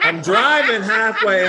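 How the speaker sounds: real laughter breaking through the line; laughing. Real, not acted. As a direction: laugh as he tells it. Laughing at his own escape.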